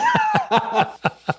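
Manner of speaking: high-pitched burst of laughter; real high giggling laugh. Real, not acted. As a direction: crack up at your own story. Cracking up at his own story.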